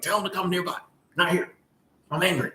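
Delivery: high, soft imitation of an older woman; lightly voiced grandmother, gently scolding; grandmotherly manner. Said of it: "she said it like a grandmotherly manner".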